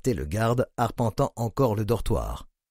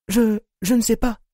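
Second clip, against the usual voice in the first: high, stammering frightened confession; ashamed admission; 10 semitones higher, 3 dB louder than usual. A narrator's reading. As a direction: stammer it out, terrified. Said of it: "avoua-t-elle honteuse".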